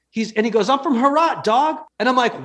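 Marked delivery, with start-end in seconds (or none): 0.7-1.8 put-on high-pitched Brooklyn street kid; kid's voice with Brooklyn slang accent. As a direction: imitate a cocky young Brooklyn hustler. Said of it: "Why do you sound like that?".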